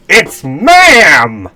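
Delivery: very high, loud, drawn-out cry; mock dramatic yell. Summing up the story, mock dramatic yell. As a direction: belt it out with the word stretched long. Breaks into exaggerated outburst mid-summary.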